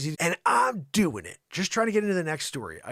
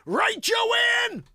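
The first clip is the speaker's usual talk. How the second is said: high, loud call across the room; hammed-up high holler; mock shout; 7 dB louder than usual. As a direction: yell it over to your co-host. Calls out to the co-host.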